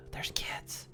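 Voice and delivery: whispering. Real, not acted. Whispering so kids won't hear.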